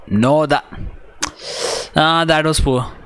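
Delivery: high, sharp cry of dismay; dismayed outcry. Real, not acted. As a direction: react in disbelief to a mistake. Groan at a poor defensive play.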